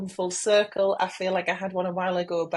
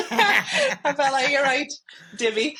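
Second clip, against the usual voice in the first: laughing; 7 semitones higher, 3 dB louder, slower than usual. Real, not acted. Laughing at her own silliness.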